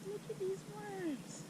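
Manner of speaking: high, thrilled squeal at the worms; gleeful high-pitched look-at-this; excited. Real, not acted. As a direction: pitch it way up with delight. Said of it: "Oh, I'm so excited".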